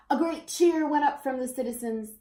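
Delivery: usual speaking voice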